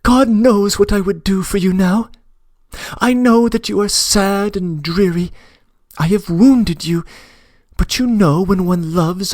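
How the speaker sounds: Nastenka pleading. Acted.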